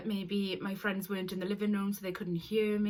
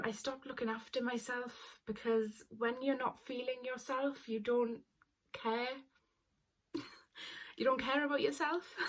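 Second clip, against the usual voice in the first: ordinary talk, nothing marked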